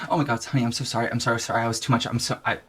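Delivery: soft, subdued motherly apology; hushed, repeated sorry; chastened apology. Acted. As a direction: back off quietly, tripping over apologies. Mother apologizing for being too much.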